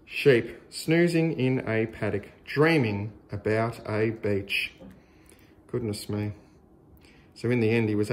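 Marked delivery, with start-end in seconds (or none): none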